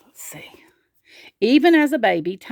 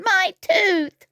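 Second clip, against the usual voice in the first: high wail of toothache; high-pitched acted cry; crying out in pain; 11 semitones higher than usual. A narrator's reading. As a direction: wail over the sore tooth. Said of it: "he cried".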